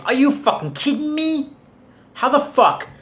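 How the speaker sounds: high, incredulous yell; exasperated outburst. Real, not acted. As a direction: blurt it out in disbelief. Ranting at a whining streamer.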